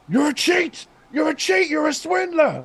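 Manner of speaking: very high, loud mock accusation; granddad's outburst. Guest acts out the granddad's cut film line, high indignant yell. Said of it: "the granddad continues talking before Willy Wonka starts shouting".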